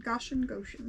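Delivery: Goshen as GOSH-en, then Goshen as GO-shen; Goshen, Goshen: "gosh" first, "go" second; trying both pronunciations. Shown as a demonstration. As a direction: test Goshen with short o, then long o. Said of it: "Or Goshen".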